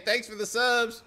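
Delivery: ordinary delivery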